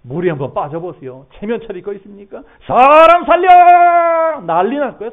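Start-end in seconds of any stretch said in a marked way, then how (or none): 2.7-4.4 물에 빠진 사람처럼 높고 크게 절규; 길게 늘여 목청껏 구조 요청; 살려달라는 고함. Acted. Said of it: "소리 지르고". (다급하게 소리치듯)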